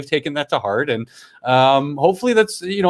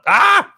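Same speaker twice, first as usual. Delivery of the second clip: short, high, loud yelp; sudden high "ah!"; mock scream; 7 dB louder than usual. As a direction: yelp in comic exasperation. Mock meltdown over silly word.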